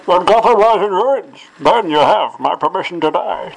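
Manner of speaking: booming masked villain; Bane voice. Pretend. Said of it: "Do your Bane".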